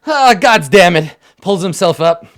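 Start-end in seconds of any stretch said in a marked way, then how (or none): 0.1-1.1 high, loud sputtering oath, slowing down; spluttering curse. Acted. Captain surfacing after falling in the river, spluttering. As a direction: come up out of the river cursing.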